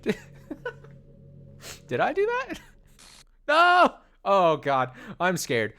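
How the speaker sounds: put-on high, loud panicked girl's voice, pleading; terrified Monika. Monika begging Austin for help while vanishing, high frightened screams. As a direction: scream for help as she's being erased.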